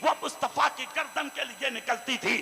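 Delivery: loud, high sermon at full force; fiery preaching. Acted. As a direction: proclaim it over the crowd. Preacher recounting battles.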